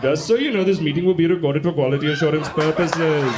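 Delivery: hammed-up Indian call-centre voice; mock Indian accent. Someone going up to an Indian person, mock Indian accent. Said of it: "you'd never go up to an Indian person".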